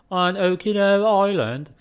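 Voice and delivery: put-on British accent. Reading the coffee blurb in a hammed-up British accent. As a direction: announce it grandly in a British accent. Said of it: "Should be talking British, innit?".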